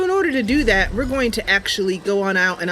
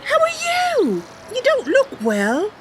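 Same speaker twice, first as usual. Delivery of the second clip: put-on much higher, eager young Bosmer girl; Mercy's voice. Mercy telling her cat Sasha about the stranger, high eager voice. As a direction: lift way up into a sweet, earnest young knight-hopeful.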